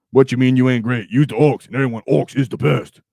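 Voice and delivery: loud, rough-edged ork boast; an ork boasting to a doubter. Acted. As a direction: bellow it like a proud orc warboss. Reading chat in ork persona.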